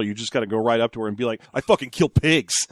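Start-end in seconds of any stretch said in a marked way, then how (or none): 1.5-2.7 cool and calm. Pretend. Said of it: "calm way in which you said".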